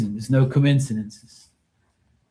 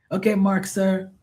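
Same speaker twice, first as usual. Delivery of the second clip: put-on high, polite Filipino-accented "sir" voice; imitating Filipino pool guy; 6 semitones higher than usual. Pool cleaner greeting Mark politely, in a high Filipino-accented voice. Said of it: "I said".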